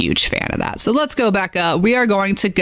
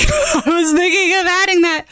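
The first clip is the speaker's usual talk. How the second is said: higher, laughing through the words; 10 semitones higher than usual. Real, not acted. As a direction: repeat it while cracking up. Repeats it, laughing at suggestion.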